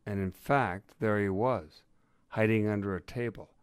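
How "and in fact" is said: plain reading voice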